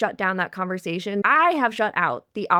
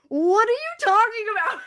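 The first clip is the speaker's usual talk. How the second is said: high-pitched mock incredulity; amused disbelief; 13 semitones higher than usual. Coach replaying reaction to dad's friend, incredulous and amused. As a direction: ask it high, in playful disbelief.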